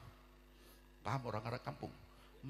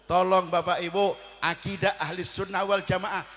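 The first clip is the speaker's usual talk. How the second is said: loud, slow, high sermon appeal; preacher begging the congregation to keep their creed; impassioned plea; 5 semitones higher, 14 dB louder than usual. Acted. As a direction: plead with them to hold their faith. Preaching at full force.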